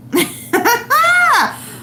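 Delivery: a burst of shrieking laughter, way up high. Real, not acted. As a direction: cackle helplessly at the picture. Laughing at his photo.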